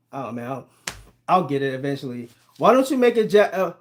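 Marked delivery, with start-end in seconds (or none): none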